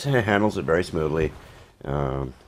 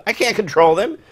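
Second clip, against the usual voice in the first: much higher, louder helpless protest; exasperated helplessness; 6 dB louder than usual. Retold or imitated. Director Wes tells the actor he can't control actresses, exasperated. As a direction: throw up your hands. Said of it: "He says".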